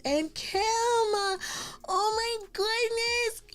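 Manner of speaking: very high, cooing squeal; excited gushing. Real, not acted. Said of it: "I'm so excited you're here".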